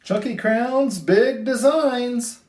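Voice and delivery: ordinary delivery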